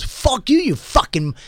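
high-pitched mock angry snap; retold outburst, starting high; imitated snapping. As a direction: snap it out as the angry stranger, without shouting. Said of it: "he just snaps at me".

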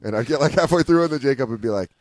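usual speaking voice

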